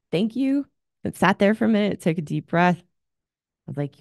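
ordinary talk, nothing marked

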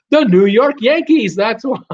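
a triumphant gotcha; loud punchline. Real, not acted. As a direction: belt out the answer like a reveal. Punchline answer to his own question.

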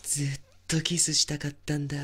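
breathy obsessed whisper. Acted. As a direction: whisper in awe, too close. Kissing sleeping woman.

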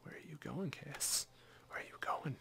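breathy stalking whisper to the fleeing enemy; a playful hunter's whisper; teasing whisper. Acted. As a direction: whisper it like you're creeping up behind him. Sneaking up on enemy Kassadin.

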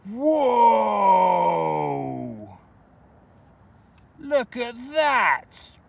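high, loud and drawn-out delight; thrilled at a surprise find; excited whoa. Real, not acted. Finds French coin, exclaims.

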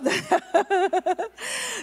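long, high-pitched laugh; real laughter. Real, not acted. Pastor amused at becoming the gang's pastor, real laughter. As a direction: burst out laughing at your own story.

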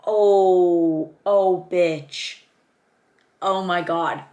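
long, loud drawn-out oh; loudly awestruck at a discovery; excited amazement. Real, not acted. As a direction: let the amazement burst out loud. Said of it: "I'm so excited".

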